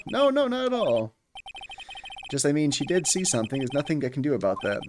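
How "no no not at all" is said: flustered denial. Acted. Voicing flustered Phoenix denial.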